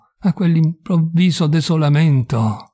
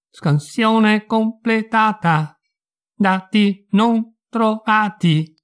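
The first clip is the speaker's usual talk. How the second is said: slow, high, robotic announcement; cold machine reading out a result; emotionless robot; 7 semitones higher, much slower than usual. Acted. The house AI answers again.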